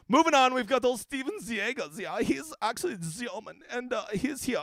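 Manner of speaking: put-on German accent; mock German announcer with a German accent. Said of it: "he actually taught me the German accent".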